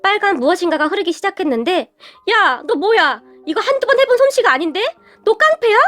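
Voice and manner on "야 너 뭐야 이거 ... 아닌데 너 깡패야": storyteller's version of a man hollering; yelling. A narrator's reading. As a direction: cry out in mock outrage at a shock. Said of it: "고래고래 소리를 질렀다고 하네요".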